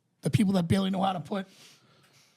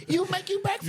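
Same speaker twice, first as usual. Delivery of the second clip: character voice; 11 semitones higher than usual. Retold or imitated. Quoting a movie character.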